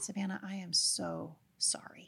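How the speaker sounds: hushed, slow, near-whispered apology; re-enacted remorse, barely above a whisper; tender apology. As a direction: say it very softly and slowly to your daughter. Retold apology to her daughter.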